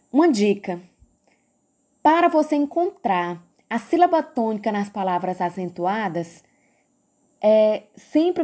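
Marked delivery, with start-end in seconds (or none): none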